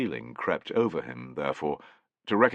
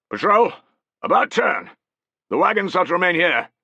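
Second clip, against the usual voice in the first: loud, high parade-ground commands; yelled orders; 12 semitones higher, 8 dB louder than usual. A narrator's reading. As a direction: yell orders to the patrol. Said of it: "he yelled".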